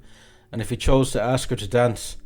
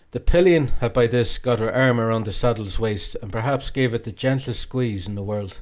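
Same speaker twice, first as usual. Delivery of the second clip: usual speaking voice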